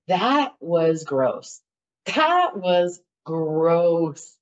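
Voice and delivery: "That was gross" very slow, each word stretched; emphatic disgust. Retold or imitated. Woman reflecting on wedding hurt, slow emphatic disgust on "gross". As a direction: drag out "gross" with weight.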